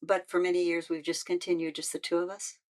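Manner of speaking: normal talking voice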